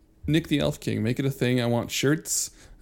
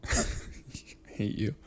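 whispered mock hate; 3 semitones lower, 10 dB quieter than usual. Real, not acted. Laughing mock insult.

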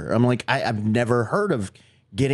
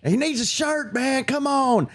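high-pitched imitation of a pushy fan; pleading dad; 11 semitones higher, slower than usual. Father begging for his boy's t-shirt, high pleading. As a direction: play a wheedling guy, pitched way up.

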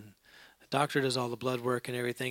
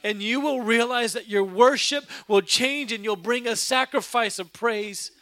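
high, loud, fervent preaching, slowing at the end; full-force preaching; 11 semitones higher, 9 dB louder than usual. Acted. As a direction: preach it out to the whole church.

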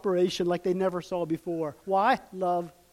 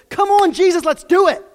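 loud, high egging on; goading inner voice; 10 semitones higher, 12 dB louder than usual. Retold or imitated. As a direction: urge him on like a teammate. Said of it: "there's enough in me that says".

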